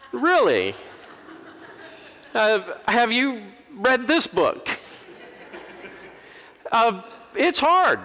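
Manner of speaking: high, slow, teasing disbelief; mock incredulous pastor. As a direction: draw out the surprise at them. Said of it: "they'll say different things and go".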